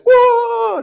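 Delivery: very high wailing "wah"; tiruan tangis. Retold or imitated. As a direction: imitate a woman bursting into a high cry. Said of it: "dia nangis". (menirukan raungan tangis tinggi)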